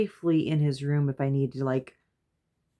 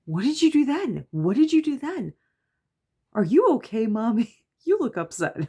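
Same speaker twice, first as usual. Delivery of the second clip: put-on high-pitched, louder child voice; put-on high kiddie voice; giggly kids asking; 8 semitones higher, 5 dB louder than usual. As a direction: imitate eager children pestering Mommy. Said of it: "They're just like".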